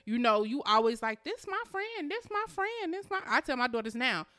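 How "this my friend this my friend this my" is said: high-pitched singling out of friends; high little-girl voice, lightly mocking; imitated eager child. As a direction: mimic a daughter's repeated claim. Mimics her young daughters about friends.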